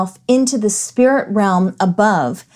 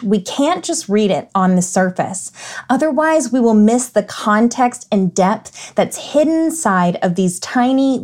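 ordinary talk

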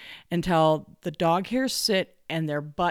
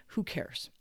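whispered aside; 9 dB quieter than usual. Real, not acted. Shrugging aside.

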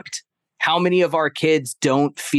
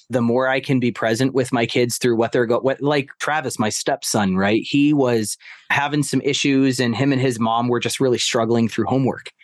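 ordinary talk, nothing marked

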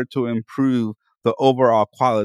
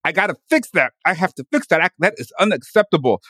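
mock self-scolding; 5 semitones higher, 4 dB louder than usual.

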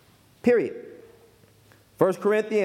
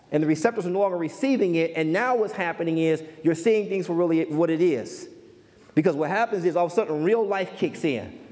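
ordinary talk, nothing marked

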